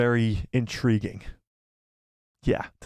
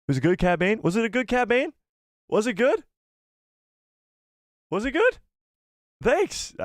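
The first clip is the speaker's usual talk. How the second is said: much higher, rising pleas; mock hopeful; 9 semitones higher than usual. Streamer fishing for praise from chat, high and hopeful. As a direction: squeak for approval. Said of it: "mock pleading".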